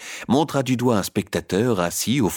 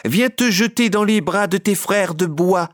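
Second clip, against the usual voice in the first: high, louder calling puppet voice; inviting call; 11 semitones higher, 4 dB louder than usual. A narrator's reading. Harlequin calls Pinocchio to the stage, high and fond. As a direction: beckon him over fondly. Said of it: "À cette affectueuse invitation".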